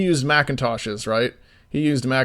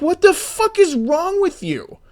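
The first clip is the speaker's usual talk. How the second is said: pitched way up, slow and exasperated; incredulous outburst; slower than usual. Retold or imitated. To the freelancer who ignored orders, high incredulous outburst. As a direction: stretch it out in disbelief, much higher.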